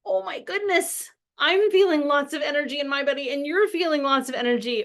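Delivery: high-pitched, energetic exclaiming; animated, worked-up parent; emotional. Acted. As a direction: burst out with big energy. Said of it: "But it might also be like".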